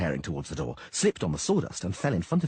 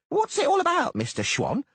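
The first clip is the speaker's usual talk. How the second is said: halfling protesting; 11 semitones higher, 3 dB louder than usual. Acted. Dainty soothing official.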